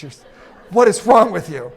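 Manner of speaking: ordinary talk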